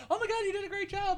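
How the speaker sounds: high, fast, gushing compliment; gushing praise. Retold or imitated. Fans praising their new announcer, gushing high and fast. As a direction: rave about the great job, quick and high. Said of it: "everyone was like".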